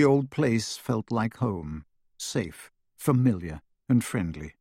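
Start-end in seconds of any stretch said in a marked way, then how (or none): none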